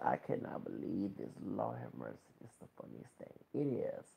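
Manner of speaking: hushed whisper of disbelief; whispered aside. Real, not acted. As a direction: let it slip out as a whisper. Aside about the video.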